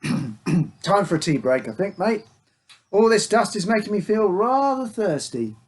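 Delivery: chummy act. A narrator's reading. Father dodging his son's questions with forced cheer. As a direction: fake breezy pal, covering something up. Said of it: "The chumminess act".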